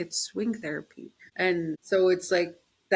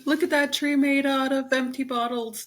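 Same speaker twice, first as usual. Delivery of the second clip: much higher, laughing mock wonder; laughing awe; 8 semitones higher, 4 dB louder than usual. Joking about admiring trash art, laughing. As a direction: gush in fake amazement. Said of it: "I'm like".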